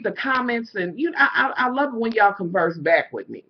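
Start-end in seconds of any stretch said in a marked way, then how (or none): none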